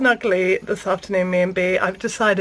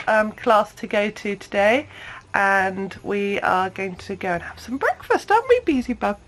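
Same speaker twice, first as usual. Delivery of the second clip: ordinary delivery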